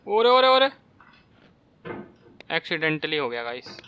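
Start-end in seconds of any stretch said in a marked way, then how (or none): 0.1-0.7 high, loud panicked cry; alarmed yelp. Real, not acted. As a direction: yelp as you slip. Falling in the game. (घबराकर ऊँची चीख)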